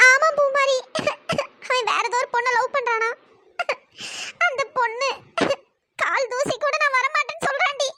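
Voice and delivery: sobbing between phrases; tearful, halting, broken by weeping; crying. Acted. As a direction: confide the rejection through sobs. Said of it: "நீ எதுக்குடி அழுதுட்டு இருக்க".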